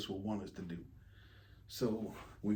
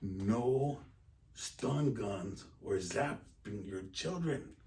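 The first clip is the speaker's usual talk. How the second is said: slow read-out; 2 semitones lower, much slower than usual. Retold or imitated. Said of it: "they had on there".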